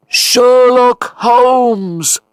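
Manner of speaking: long, high echoing call; echo of a shout. A narrator's reading. Watson's own voice returning from the falls, echoing. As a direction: send the shout back as a drawn-out echo. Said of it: "came the echo of my own voice".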